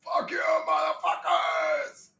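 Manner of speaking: high, slow, drawn-out screamed curse; screamed insult. Pretend. Streamer screaming at the video, high and drawn out. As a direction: stretch the curse into a long scream.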